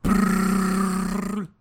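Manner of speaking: buzzing nail gun sound effect; nail gun noise. Shown as a demonstration. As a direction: make a whirring tool sound with your lips. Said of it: "That was me shooting a nail gun".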